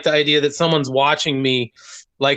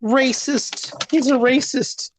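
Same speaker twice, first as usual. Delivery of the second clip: mock accusing voice; 10 semitones higher, much slower than usual. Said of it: "they'll be like".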